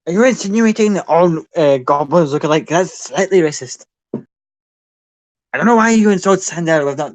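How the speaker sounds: loud, high, offended goblin; indignant goblin. Acted. Goblin feigning outrage at his victim's insult. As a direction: play the goblin mock-offended and huffy.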